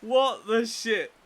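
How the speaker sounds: slow, loud, high disbelief; shocked outburst. Real, not acted. Narrator reacting to the scene, loud shocked disbelief. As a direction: blurt it out stunned.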